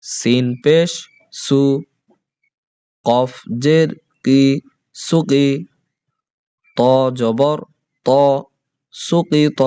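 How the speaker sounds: teacher's chanted letter-by-letter recitation; spelling drill. Shown as a demonstration. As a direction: spell out each syllable for the class to repeat. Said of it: "তাড়াতাড়ি করে হরকতগুলো পড়তে হয়".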